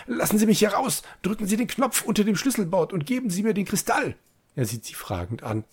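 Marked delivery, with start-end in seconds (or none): 0.1-4.1 high urgent orders; urgent commanding. A narrator's reading. Caged man demands release and crystal, urgent and high. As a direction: demand it, much higher. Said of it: "ruft er im Befehlston".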